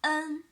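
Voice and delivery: "en" drilled for copying; clear demo syllable. Shown as a demonstration. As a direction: model "en". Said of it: "And the next one".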